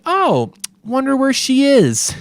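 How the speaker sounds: high, sing-song fake wonder; mock innocent.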